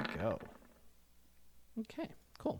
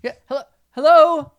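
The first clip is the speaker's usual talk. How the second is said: weak voice calling; 15 dB louder than usual. Acted. GM repeats the survivor's call.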